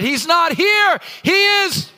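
high, loud congregation shout; joyful call back; echoing shout. Retold or imitated. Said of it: "Somebody shouted".

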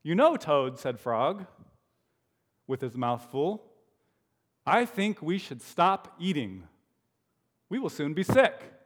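put-on lively read-aloud for children; animated story narration; storybook reading. A narrator's reading. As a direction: read it like a bedtime picture book.

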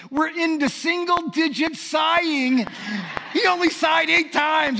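high, loud, drawn-out jubilation; mock triumph. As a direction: imitate an elated wife proclaiming progress. Wife exulting over fewer sighs.

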